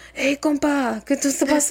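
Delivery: lightly voiced sleepy mumble in Spanish; imitating sleep talk. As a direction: mimic a friend talking in his sleep. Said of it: "he'll be like".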